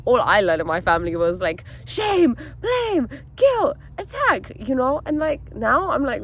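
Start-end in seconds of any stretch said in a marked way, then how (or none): 1.8-4.5 very high-pitched mock attack, word by word; mimicked attacking. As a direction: fire off each accusation in a high voice. Mimics family's way of talking.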